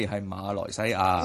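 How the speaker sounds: 馬來西亞 said slowly, every syllable sounded; full-word correction. Shown as a demonstration. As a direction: stretch out 馬來西亞, including the opening 馬. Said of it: "唔係來西". (慢慢讀齊「馬來西亞」每個音)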